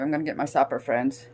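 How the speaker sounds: ordinary talk, nothing marked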